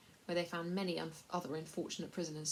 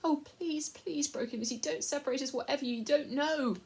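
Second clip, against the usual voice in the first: higher, desperate begging; pleading girl; 9 semitones higher, 6 dB louder than usual. A narrator's reading. Lucy begging the lord not to separate them, desperate. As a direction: beg not to be parted.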